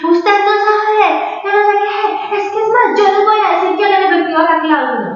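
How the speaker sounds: high, loud mock tirade; retold angry mother. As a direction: imitate mom ranting and threatening. Mother's shouted threats, retold.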